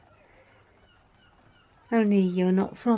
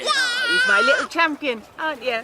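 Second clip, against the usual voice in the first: proud cooing; 6 semitones higher than usual. Acted. Praising the boy who won the race, delighted.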